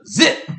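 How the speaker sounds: sudden high, loud zip; imitated jab sound. Boyfriend mimics a little jab to the chest, sharp zip. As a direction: punch it out like a quick jab.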